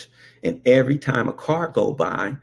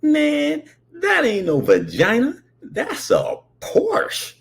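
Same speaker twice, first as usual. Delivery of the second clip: much higher, slow, breathy disbelief; scoffing kid; 12 semitones higher, slower than usual. Retold or imitated. Boy correcting brother about the Porsche, high scoff. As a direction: scoff at him, high and incredulous. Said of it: "And I said".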